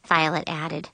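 usual speaking voice

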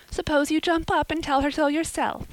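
high, merry woman's voice. A narrator's reading. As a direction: suggest it merrily, pitched high. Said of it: "said a merry voice".

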